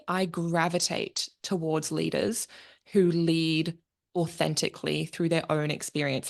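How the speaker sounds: usual speaking voice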